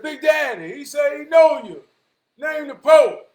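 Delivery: loud high holler up to the Father. Retold or imitated. Jesus hollers up to the Father about the Pope, shouting. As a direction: yell across heaven for Big Daddy.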